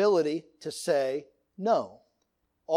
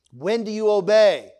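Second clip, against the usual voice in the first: louder call-and-response question; dad's quiz call; 3 semitones higher, 7 dB louder than usual. Retold or imitated. Father drilling kids on obedience, loud call. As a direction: bark it as the drill leader. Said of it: "dad quizzing kids".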